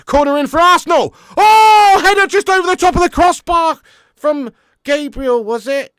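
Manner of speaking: loud, high, rising yell at the near miss; excited commentary. Real, not acted. Streamer reacting to Arsenal's near miss, excited loud shout. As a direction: call the header like a commentator leaping up.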